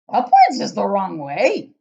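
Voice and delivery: booming giant cry, slow. Acted. Giant correcting Sophie, loud indignant cry. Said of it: "cried the B F G".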